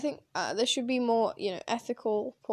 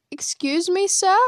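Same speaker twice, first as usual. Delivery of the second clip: indignant; 7 semitones higher, 7 dB louder than usual. Pretend. Mock-addressing a homophobe.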